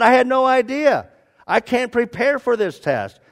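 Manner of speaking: high, loud acted protest; alarmed protest. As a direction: protest like you've been caught unprepared. Man acted protesting surprise test.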